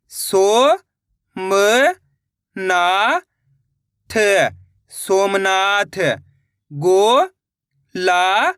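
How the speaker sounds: slow, each syllable said on its own with pauses. Shown as a demonstration. Teacher sounding out words for young readers, slow and syllabic. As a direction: spell it out for beginners, then blend the word. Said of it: "इस तरीके के शब्द को कैसे पढ़ेंगे आप?".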